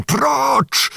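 high, commanding cry to stand back; sudden shout. A narrator's reading. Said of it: "krzyknął nagle Bohun". (nagły, wysoki okrzyk rozkazu)

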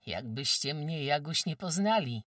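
lightly voiced young villager; a hint of a shy suitor; young man's voice. Narrator voices Jasio.

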